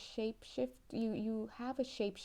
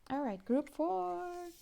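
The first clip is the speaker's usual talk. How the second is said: sing-song call; 10 semitones higher, 4 dB louder, slower than usual. Real, not acted. Calling the group, sing-song.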